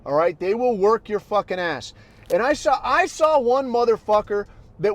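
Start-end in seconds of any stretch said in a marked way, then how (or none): none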